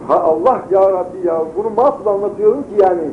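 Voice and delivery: normal talking voice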